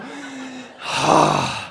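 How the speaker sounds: long, heavy huff of air; exasperated sigh. Acted. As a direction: let out a fuming sigh. Fuming at the dented car.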